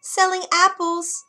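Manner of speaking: put-on the disguised queen squeaks like a harmless old woman; squeaky old woman. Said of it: "came a squeaky voice".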